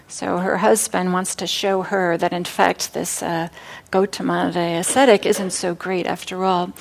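usual speaking voice

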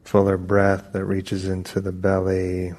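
unhurried meditation guidance with long pauses; calm, even voice, drawing out the phrase endings; guided meditation. Acted. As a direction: ease them gently into stillness, phrase by phrase.

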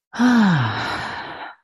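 long audible sigh, falling from high; settling sigh. Real, not acted. Settling into meditation.

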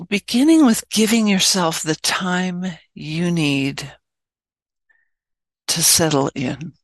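unhurried, spacious delivery, phrase by phrase; guided meditation. Real, not acted. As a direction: ease listeners into stillness, leaving space between phrases.

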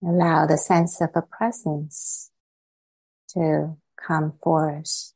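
unhurried meditation guidance with long silences between phrases; calm, spacious meditation voice; guided meditation. Real, not acted. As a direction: ease listeners into stillness, phrase by phrase.

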